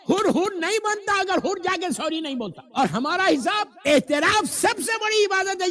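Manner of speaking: high, loud burst of admonishment; fervent preaching. Acted. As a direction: exhort the congregation at full force. Impassioned admonishing of congregation. (پرزور خطیبانہ تنبیہ)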